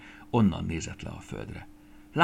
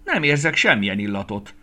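loud, raised outcry; exclaiming; 4 semitones higher, 8 dB louder than usual. A narrator's reading. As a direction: cry out in indignation. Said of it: "kiáltott fel". (hangos felkiáltás)

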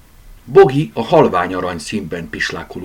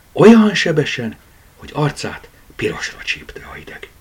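ordinary delivery, nothing marked

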